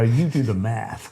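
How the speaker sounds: put-on slow, offhand American accent. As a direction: slip into an American accent for a quip. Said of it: "I don't know why I did an American accent there".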